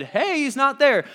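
high, loud excited exclaim; mock-excited women at the tomb; excited report. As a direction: burst out with the news.

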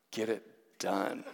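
low, laughing command; firm order. Retold or imitated. Girlfriend urging him before leaving, low firm laughing order. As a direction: push him to act now. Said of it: "she just looks at me and she goes".

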